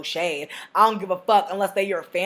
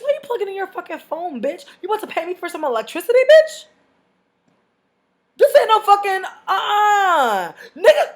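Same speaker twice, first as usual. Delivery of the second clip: fast, then high and loud with a cry in the voice; outraged squeal; 8 semitones higher, 5 dB louder than usual. Retold or imitated. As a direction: climb into disbelief. Outraged about the phone charging.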